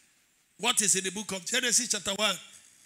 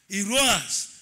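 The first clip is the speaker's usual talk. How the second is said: usual speaking voice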